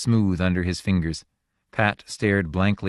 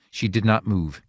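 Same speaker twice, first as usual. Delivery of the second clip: plain reading voice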